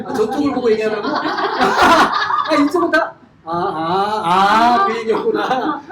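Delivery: laughing aloud at a misunderstanding. Real, not acted. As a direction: chuckle through the realization. Laughs at audience remark.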